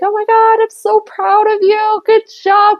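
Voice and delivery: high, sugary coworker praise; gushing coworker. Retold or imitated. Coworker gushing praise at her on return. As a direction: gush like an overly proud coworker.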